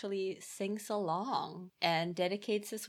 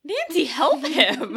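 slow, much louder, higher plea laced with laughter; urging shout; 8 semitones higher, 12 dB louder, slower than usual. Retold or imitated. Viewer yelling at Nancy on screen, laughing. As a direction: yell at the screen, half laughing. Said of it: "And I'm like".

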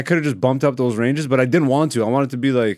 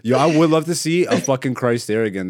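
normal talking voice

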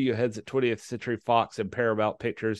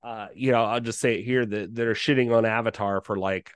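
normal talking voice

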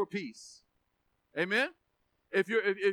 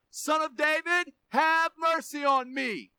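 louder, drawn-out plea for mercy; insistent raised call, last words stretched; crying out more; 4 semitones higher, 4 dB louder, slower than usual. Acted. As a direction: cry it out over those hushing you. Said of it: "he cried out all the more".

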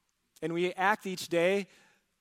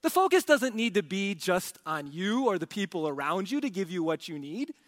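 usual speaking voice

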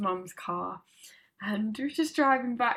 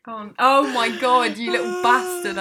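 loud laughing protest; 3 semitones higher, 9 dB louder than usual. Real, not acted. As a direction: shout at your friend in fond protest. Friend knows embarrassing story coming.

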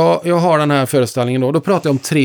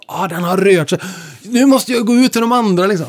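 very high, thrilled, hurrying off; excited child; 6 semitones higher than usual. Retold or imitated. Boy sees the clock has moved and rushes off, thrilled and high. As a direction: burst out with a child's joy. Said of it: "tittade vi så här på klockan".

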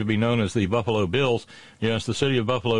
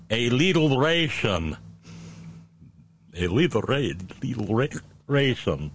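mock Slovenian accent; 3 semitones higher, slower than usual. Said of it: "translated in Melonian would be".

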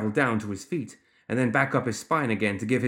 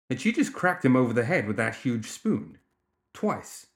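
plain reading voice

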